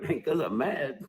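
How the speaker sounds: ordinary talk, nothing marked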